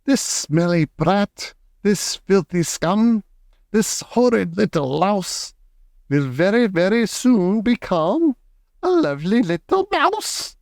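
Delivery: put-on the witch gloats over the boy; witch shouting. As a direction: savour each insult, then sing out mouse. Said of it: "she shouted out".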